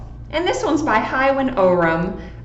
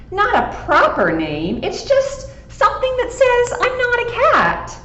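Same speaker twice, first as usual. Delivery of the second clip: lightly voiced dog, higher and aggrieved; complaining dog; 14 semitones higher than usual. Dog grumbling to the cat, a hint of a sulky voice. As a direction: whine it out as the moping dog.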